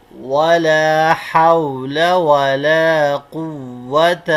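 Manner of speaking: slow, drawn-out Arabic recitation, word by word. Shown as a demonstration. Teacher reciting the kalima slowly for students to follow. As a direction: chant each word long for the class to repeat.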